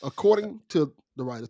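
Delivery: ordinary delivery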